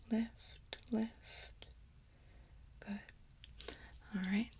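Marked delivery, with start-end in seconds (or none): none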